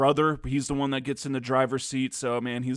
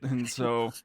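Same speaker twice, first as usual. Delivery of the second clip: usual speaking voice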